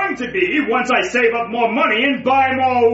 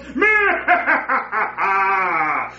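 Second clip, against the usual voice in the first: evil laugh; 3 semitones lower than usual. Acted. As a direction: cackle over the plan. Villain laughs.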